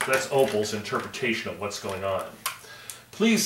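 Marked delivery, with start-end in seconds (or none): 3.1-3.5 high pleading please; pleading manager. A narrator's reading. Store manager begging the staff, high and pleading. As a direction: beg like the flustered store manager. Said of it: "said the manager".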